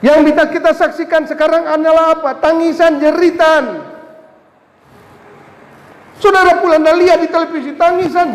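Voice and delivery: high, loud lament from the pulpit; impassioned preaching. Acted. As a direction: cry out each word high and loud. Preaching about suffering. (ratapan khotbah, suara tinggi dan keras)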